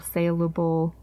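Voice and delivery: "saleable" with first syllable rhyming with pail; trying a pronunciation. Shown as a demonstration. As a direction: say "saleable" with a long AY, like sale. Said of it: "we're just gonna go with saleable".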